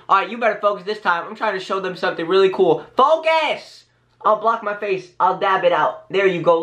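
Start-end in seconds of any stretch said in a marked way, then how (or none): 3.0-3.9 exasperated call. Real, not acted. Scolds the camera for not focusing.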